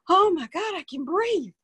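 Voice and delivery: high, loud burst of amazed relief; amazed friend. Retold or imitated. Friend retelling her first easy breath, sudden high amazed relief. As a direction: cry it out like breath just came back. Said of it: "She said".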